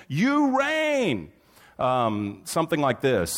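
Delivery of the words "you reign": high, held cry of praise; demonstrated worship shout. Shown as a demonstration. Preacher showing shouting with content, demonstrated shout. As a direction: call out high, stretching the last word. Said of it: "to yell out something with content".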